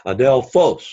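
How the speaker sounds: adelphos with PHOS punched; stress on last syllable. Shown as a demonstration. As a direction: lean on the final syllable of "adelphos". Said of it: "the accent is on the last syllable".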